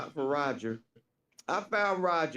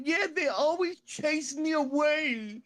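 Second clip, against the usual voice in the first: high, slow, plaintive whine; Roger impression; 8 semitones higher, slower than usual. Homeless man complaining of being chased off, high slow whine. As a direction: drag out a high-pitched grievance. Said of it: "Roger said".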